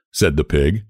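ordinary delivery, nothing marked

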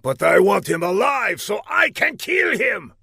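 high, hammed-up shouted order; high, menacing mock yell; villain yelling. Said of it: "he yelled".

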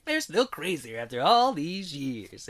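slow, drawn-out trailer voiceover parody. Retold or imitated. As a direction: imitate an old movie trailer, stretching the words. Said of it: "is this the trailer?".

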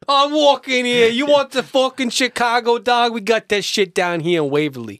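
put-on loud, high, brash New York Italian accent; hammed-up New York Italian hawker, yelling; mock Italian-American. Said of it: "we can do it Italian".

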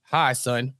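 low-pitched parody of a stepdad; mock stepdad greeting. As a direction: put on a low, fatherly hello. Acts Bobby walking up to Harvey.

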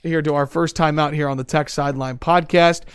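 ordinary talk

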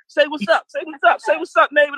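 high, eager shout-out to the neighbor; excited call-out. Real, not acted. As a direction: hype her up like greeting a friend. Host greets the neighbor guest.

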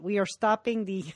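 a lightly put-on Russian accent, quiet; Russian accent, half-laughing through it; mock Russian accent. Host imitating the Russian president in a Russian accent. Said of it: "You do the Russian accent".

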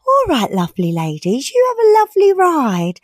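friendly officer, rising high at the end; sing-song cheery policeman. Retold or imitated.

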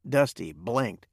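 usual speaking voice

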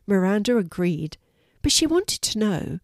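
ordinary delivery, nothing marked

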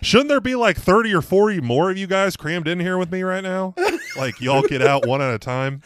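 high, loud clown-car jab; loud mocking taunt. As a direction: taunt them loudly. Taunting cop about clown cars.

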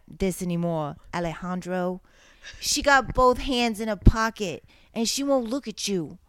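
dramatic lyric read in a hammed-up Jersey voice; mock Jersey accent, dramatic. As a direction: read the lyrics like a Jersey girl, over the top. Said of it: "if you're in a Jersey voice".

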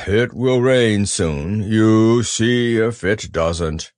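doom-laden, drawn-out; gloomy Eeyore. Acted. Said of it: "nodded gloomily".

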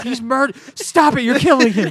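hammed-up alarmed pleading; panicked bystander. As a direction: plead like a frightened onlooker. Crowd pleading at fight.

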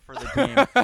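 chuckling while talking; laughing. Real, not acted. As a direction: talk through a laugh. Laughing after the hidden talent.

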